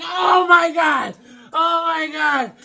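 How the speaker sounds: high, slow, laughing shout of joy; thrilled shout. Retold or imitated. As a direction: scream it out in disbelief. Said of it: "I was like".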